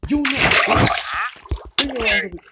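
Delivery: loud furious yell. Real, not acted. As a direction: shout down the attacker. Still yelling.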